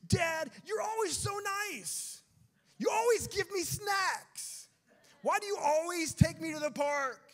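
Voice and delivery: high, drawn-out mock tantrum yell; little child yelling. Little one yelling at Dad over kindness, mock tantrum. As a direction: wail it loud like an outraged little kid. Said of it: "your little one starts yelling at you".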